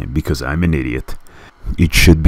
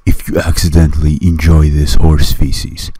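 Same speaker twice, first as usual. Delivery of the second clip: loud mock public warning; forceful, deadpan disclaimer read; mock warning announcement; 5 dB louder than usual. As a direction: announce a disclaimer with fake gravity. Mock warning opening the video.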